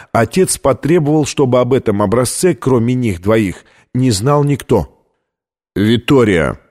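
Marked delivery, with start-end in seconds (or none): 5.7-6.6 name called in a mildly tense voice; a touch of tension, falling at the end. A narrator's reading. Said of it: "напряжённым голосом произнёс Колер". (напряжённым голосом)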